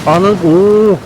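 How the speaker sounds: loud, high cry of surprise; real astonished exclamation, drawn into an "oo"; startled amazement. Real, not acted. Player reacting to a sudden sight in game, amazed.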